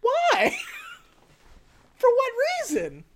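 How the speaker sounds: baffled by the movie's logic; incredulous why. Real, not acted. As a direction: throw up your hands in disbelief. Mocking the film's plot.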